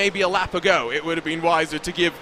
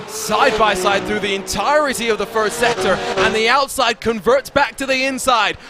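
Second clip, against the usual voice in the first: lifted, energetic commentary over the fight for second; race play-by-play; 4 semitones higher than usual. Acted. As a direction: paint the wheel-to-wheel battle for viewers. Second commentator's lap call.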